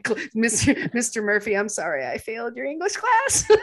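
high, loud apology breaking into laughter; laughing mock apology. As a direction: laugh through a playful confession to an old teacher. Mock apology to English teacher, laughing.

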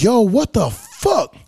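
high, drawn-out disbelief; incredulous protest; baffled exclamation. Retold or imitated. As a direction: exclaim it slowly in exasperated bewilderment. Retells his reaction to few matches.

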